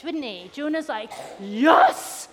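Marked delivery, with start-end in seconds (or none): 1.4-2.3 loud yes, slow proud boast; acted elation, drawn out; excited triumph. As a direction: celebrate like a delighted prophet. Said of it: "so excited about this".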